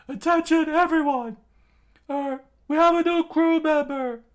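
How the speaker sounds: high, very loud proclamation; screamed announcement. A narrator's reading. Usopp announcing a new crew member, screaming. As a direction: shout the news over a rowdy party. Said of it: "Usopp screamed out as he raised".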